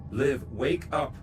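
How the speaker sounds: slow, loud, desperate; desperate plea. Acted. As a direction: beg her to wake up. Begging her to wake.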